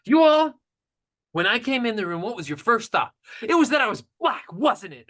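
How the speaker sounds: loud, high accusing question; guy challenging the class, loud and high; accusing challenge. Retold or imitated. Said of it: "he went on and said".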